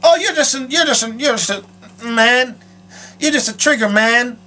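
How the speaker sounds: high mock voice of someone misusing the word; lightly voiced clueless person; a hint of a careless outsider; imitating outsiders. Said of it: "They say it".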